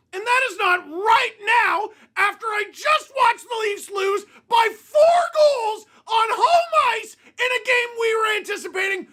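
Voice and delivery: angry fan rant; angry yell. Real, not acted. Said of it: "about to do a lot of it".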